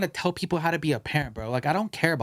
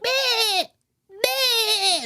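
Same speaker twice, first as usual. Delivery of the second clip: put-on high toddler whine; imitating a toddler; 3 dB louder than usual. Imitating the loud toddler at the movie, high whiny meh. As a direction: mimic a fussing two-year-old in the cinema. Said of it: "He was sitting there like".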